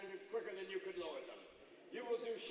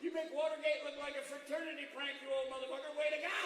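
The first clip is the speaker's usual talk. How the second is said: loud, high-pitched backslapping; boisterous congratulations; 7 semitones higher, 6 dB louder than usual. Acted. Devil praising a crony's scandal, boisterous. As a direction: cheer on an old crony.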